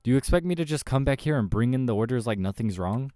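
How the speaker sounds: exasperated. Acted. Ignored server confronts kitchen.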